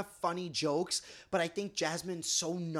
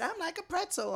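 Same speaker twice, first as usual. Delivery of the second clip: higher-pitched mimicking quote; quoting a housemate; 11 semitones higher than usual. Co-host retelling a favorite show moment, high mimicking quote. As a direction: lift the pitch to quote her. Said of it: "When she said".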